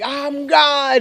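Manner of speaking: high, drawn-out exultant cry; long, ecstatic mock scream; retold scream. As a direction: belt it out high, like a proclamation. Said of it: "Just screaming my lungs out".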